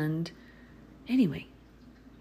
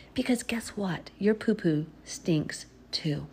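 usual speaking voice

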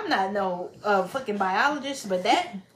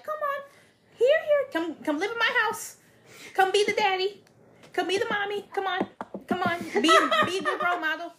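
high, sweet coaxing plea; coaxing partner voice; 9 semitones higher than usual. Retold or imitated. Partner pleading with her man to settle down, high and sweet. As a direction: coax him home like a hopeful partner.

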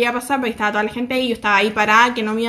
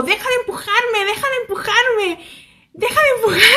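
high, mock-outraged yell breaking into laughter; protesting; 9 semitones higher than usual. Vlogger yelling at the bodyguard pushing her, protesting.

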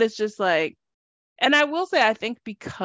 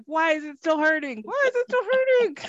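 rising pained whine; 8 semitones higher than usual. Retold or imitated. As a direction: whine climbing much higher each time. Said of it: "And then I'll be like".

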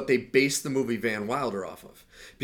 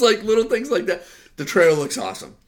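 ordinary talk, nothing marked

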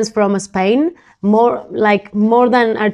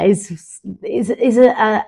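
ordinary talk, nothing marked

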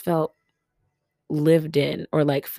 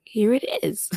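whispery mock wheeze, pitched up; voiceless, wheezy self-mockery; exaggerated wheeze; 5 semitones higher than usual. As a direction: wheeze it out, imitating your own voice. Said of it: "when my voice wheezes like that".

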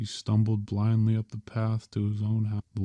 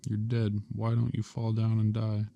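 ordinary delivery

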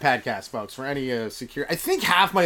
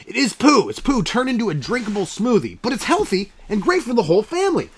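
high, beaming salesman voice in a skit; pushy pitchman; 6 semitones higher, 4 dB louder than usual. Acted. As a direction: sell it like a cheesy TV ad guy. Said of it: "I got a commercial coming up".

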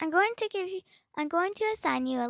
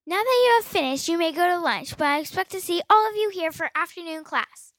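louder, commanding dismissal; stern loud command; 5 dB louder than usual. Acted. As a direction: still a monster, barking orders. Teacher releases students sternly.

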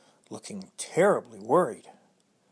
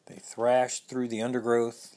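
plain reading voice

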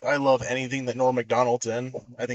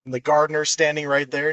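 ordinary talk

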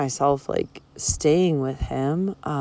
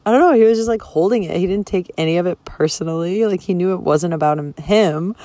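ordinary delivery

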